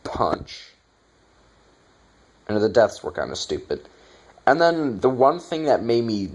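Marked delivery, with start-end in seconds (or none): 0.0-0.7 breathless half-hearted whisper; mock the sluggish fighter, whispered; a limp, unvoiced imitation; mock lazy fighter. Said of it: "they're just walking around".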